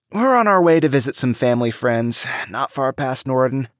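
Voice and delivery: breezy, covering a lie; casual lie. A narrator's reading. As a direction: act relaxed while lying. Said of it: "trying to look relaxed with his lie".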